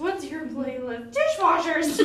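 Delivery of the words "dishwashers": loud, high laughing blurt; laughing shout. Real, not acted. Host answering her cohost's question, laughing shout.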